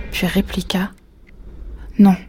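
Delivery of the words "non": a short, firm no; firm refusal. A narrator's reading. Wife turning down husband's outing, firm. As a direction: refuse firmly in one word. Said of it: "répéta-t-elle d'une voix ferme".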